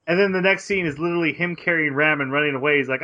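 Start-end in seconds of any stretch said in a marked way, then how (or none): none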